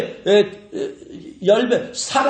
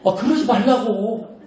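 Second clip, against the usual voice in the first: normal talking voice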